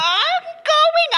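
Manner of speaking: high, drawn-out exasperated protest; high-pitched, indignant stretch; defensive protest. Acted. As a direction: protest shrilly at being rushed. Mother snaps back at son's instruction.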